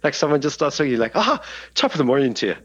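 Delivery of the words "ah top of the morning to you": higher-pitched stage Irish greeting; Irish accent. Acted. Podcaster showing how an Irish accent pops out, higher mock-Irish. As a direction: pop into a put-on Irish brogue. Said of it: "somebody talks in an Irish accent to me".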